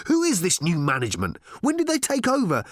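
rising, exasperated questioning; insistent. A narrator's reading. Ford grilling the robot about the takeover, insistent. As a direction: press question upon question, pitch climbing. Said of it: "insisted Ford".

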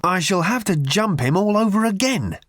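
high, exasperated outburst; raging. A narrator's reading. Ford must re-enact it for Arthur, raging. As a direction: work up into a high-pitched huff. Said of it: "raged Ford".

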